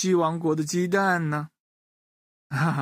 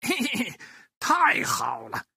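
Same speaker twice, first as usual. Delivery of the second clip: gleeful character cheer; 8 semitones higher than usual. Acted. Storyteller acts a delighted character for children, gleeful and high. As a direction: chuckle with glee, then stretch out the delighted cry.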